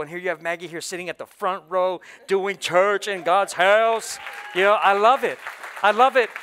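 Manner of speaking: loud, rising preacher's cry; preaching at full force. Acted. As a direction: preach it out, building to a shout.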